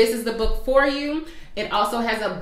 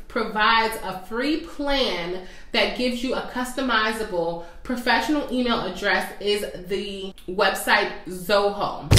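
normal talking voice

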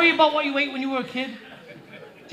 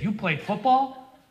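slow disbelief; much slower than usual. Retold or imitated. Incredulous slow question.

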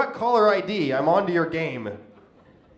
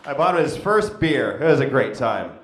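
ordinary delivery

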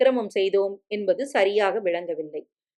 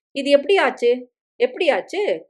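shocked; 5 semitones higher, 4 dB louder than usual. Acted. Shocked woman sees dead lover.

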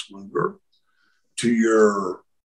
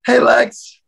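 high, loud greeting across the gym; pitched up, projected hail; calling out; 12 semitones higher, 7 dB louder than usual. Retold or imitated. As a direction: call out eagerly to get his attention. Gym member calls out to Lex.

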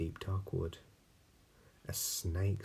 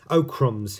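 alarmed snake; 6 semitones higher, 13 dB louder than usual. Acted. Snake flees gruffalo.

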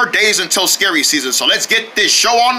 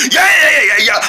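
excited yeahs; 6 semitones higher, 4 dB louder than usual. Acted. Pumping himself up.

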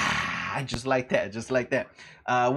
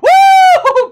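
a very high, loud whoop of joy; 19 dB louder than usual. Real, not acted. Streamer celebrating a close escape, loud high whoop. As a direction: whoop like you just won.

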